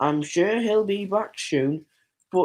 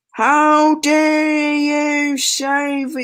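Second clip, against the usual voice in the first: mock outrage; 6 semitones higher, much slower than usual. Roleplaying an indignant character.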